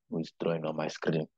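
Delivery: puzzled low aside; distracted aside. Real, not acted. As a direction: drop the voice to question an interruption. Breaks off lecture over screen drawing.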